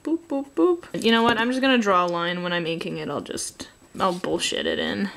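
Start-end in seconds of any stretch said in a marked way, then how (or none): none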